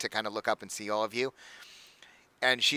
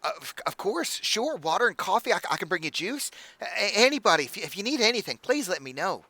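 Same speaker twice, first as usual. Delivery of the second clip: high, gushing hostess voice; bubbly halfling innkeeper; 7 semitones higher than usual. Acted. Innkeeper welcoming the party, high and gushing. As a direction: fuss over the guests in a bright, much higher voice. Innkeeper taking drink orders.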